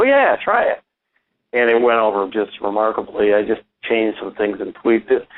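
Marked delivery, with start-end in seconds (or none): 0.0-0.8 high mock voice. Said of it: "I go".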